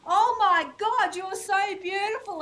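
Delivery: high, loud gushing praise; gushing compliment. Retold or imitated. Strangers in public gushing over her beauty, high and loud. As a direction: gush it out like a starstruck stranger.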